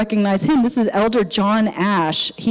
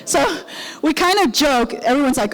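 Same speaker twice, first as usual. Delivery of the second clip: ordinary talk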